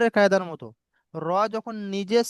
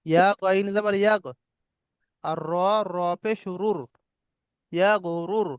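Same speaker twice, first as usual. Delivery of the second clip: ordinary talk, nothing marked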